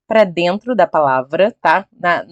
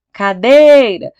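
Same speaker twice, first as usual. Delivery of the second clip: "cadeira" shouted as if at the gate, DEI stretched long; calling out loudly; 3 semitones higher, 7 dB louder, much slower than usual. Shown as a demonstration. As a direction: call "cadeira" out, dragging the "dei" high and loud. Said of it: "chama a cadeira, alto no portão". (chamando "cadeira" alto no portão, com o "dei" bem esticado)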